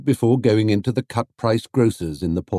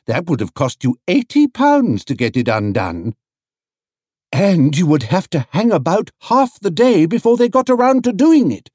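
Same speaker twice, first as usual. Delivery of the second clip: higher, louder busybody voices; chatty ladies; 9 semitones higher, 5 dB louder than usual. A narrator's reading. Market ladies warn Paddington about clamping, chatty. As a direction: pile on the bad news helpfully.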